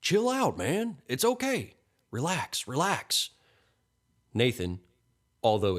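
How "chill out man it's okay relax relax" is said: high, hurried-sounding reassurance; urgent placating. A narrator's reading. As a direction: talk down a panicking stranger, pitched high. Man hushing a panicked stranger.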